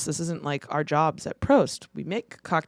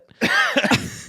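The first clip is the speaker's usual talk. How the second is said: high laugh. Real, not acted. Laughing at the order.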